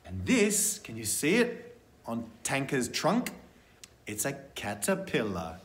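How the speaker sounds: ordinary delivery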